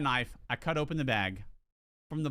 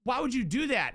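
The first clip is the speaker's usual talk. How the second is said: retold outraged shout; 8 semitones higher than usual. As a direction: yell at Jose in disbelief. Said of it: "I was like".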